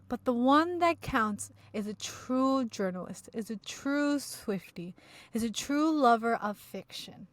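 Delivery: slow, grand build; slow dramatic tribute. Pretend. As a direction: lay it on thick.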